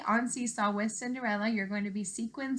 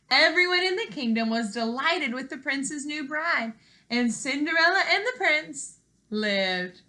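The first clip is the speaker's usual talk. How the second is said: higher, louder happy ending read aloud; storybook finale; 4 semitones higher, 7 dB louder than usual. A narrator's reading. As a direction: swell into the happily ever after.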